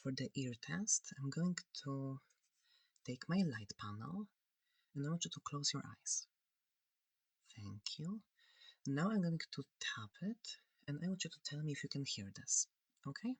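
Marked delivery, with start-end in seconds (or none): none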